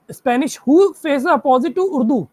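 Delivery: slow, measured clue reading. Shown as a demonstration. Teacher dictating the next clue to the class, slowly. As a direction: read each word out carefully. Reads puzzle clue aloud.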